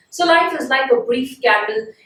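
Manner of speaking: ordinary delivery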